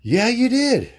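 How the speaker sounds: high, loud yeah, you did; indignant retort. Retold or imitated. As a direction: shoot back in disbelief.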